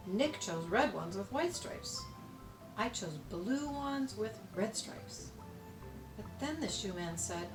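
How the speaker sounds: usual speaking voice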